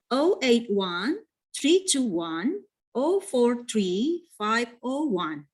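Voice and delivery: "oh eight one three two one" read slowly in groups of three; grouped in threes. Shown as a demonstration. Teacher models a phone number, slow "oh eight one" style three-digit groups. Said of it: "by three numbers or two numbers first".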